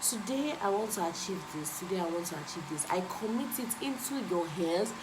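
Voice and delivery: soft, hushed prayer to God; quiet prayer. Retold or imitated. Vlogger showing viewers how to pray, soft and hushed. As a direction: pray it softly, as a model. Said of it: "Tell God".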